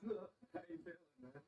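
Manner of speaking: lightly hammed-up questioner, soft and high; interviewer. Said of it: "It's like".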